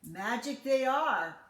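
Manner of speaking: lightly voiced croaky old man; little man promising Jack, loud; croaking little man. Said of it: "croaked the little man".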